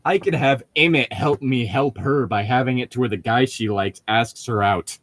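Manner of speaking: ordinary delivery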